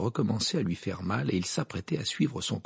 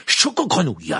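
loud, high angry cry; furious shout; 4 semitones higher, 10 dB louder than usual. A narrator's reading. Harry hopping on one foot after kicking gargoyle, furious. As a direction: shout the word out in fury. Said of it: "s'écria-t-il furieux".

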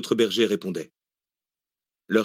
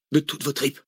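higher-pitched, urgent push; mildly insistent coaching; 6 semitones higher than usual. A narrator's reading. Wounded man urges the researcher to give her all, insisting. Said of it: "insista Zach".

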